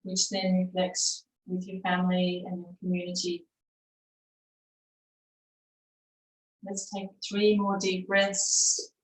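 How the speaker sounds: unhurried, settled meditation cueing; guided meditation. Acted. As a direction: guide them gently into stillness. Leads a breathing meditation.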